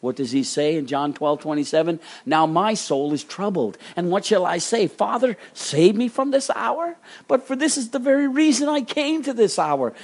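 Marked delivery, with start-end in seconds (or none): 2.2-9.9 lightly acted anguished plea, pitched high; Jesus wrestling aloud, mildly dramatized; troubled prayer. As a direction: rise into an urgent questioning prayer.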